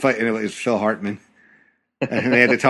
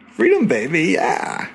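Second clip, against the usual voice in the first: Austin Powers line; 6 semitones higher than usual. Retold or imitated.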